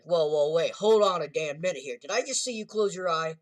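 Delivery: loud, rising shocked disbelief; stunned yell at a friend; shocked double take. Retold or imitated. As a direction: call out a shocked stop. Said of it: "And he's like".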